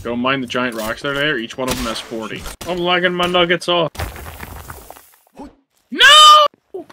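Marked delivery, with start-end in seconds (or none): 5.9-6.9 high, loud shout; anguished shout. Real, not acted. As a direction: cry out in dismay. Sudden loss in game.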